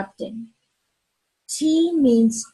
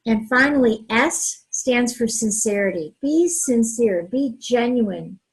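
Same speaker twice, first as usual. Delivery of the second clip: normal talking voice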